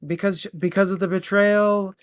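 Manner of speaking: ordinary talk, nothing marked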